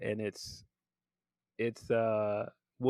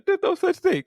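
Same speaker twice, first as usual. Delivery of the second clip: high, loud mock yell; acted outburst; 7 dB louder than usual. Acting out the cop's outburst.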